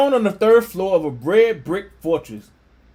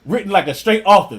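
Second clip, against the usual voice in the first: usual speaking voice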